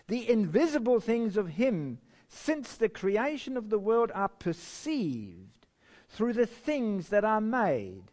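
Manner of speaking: solemn pulpit reading of a devotional text; reverent reading. Acted. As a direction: read it out with awe, drawing out each phrase.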